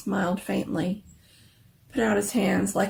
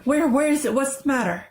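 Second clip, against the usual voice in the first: higher and louder in alarm; startled out of sleep; 7 semitones higher, 5 dB louder than usual. A narrator's reading. Husband woken by his wife's cry, alarmed. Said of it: "He started up in alarm".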